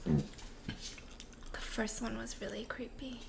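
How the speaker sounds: slow, very soft whisper; creeped-out whisper. Real, not acted. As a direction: whisper it as if spooked. Creeped-out listener judges the story.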